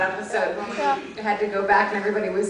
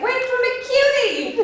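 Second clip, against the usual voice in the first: high, slow mock yell of Wait for McCutie; retold yell; slower than usual. To a cast mate running after the van, mock yelling. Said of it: "yelled it".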